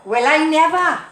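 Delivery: loud, high mock Welsh exclamation; attempted Welsh accent. Shown as a demonstration. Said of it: "I was gonna do a Welsh accent".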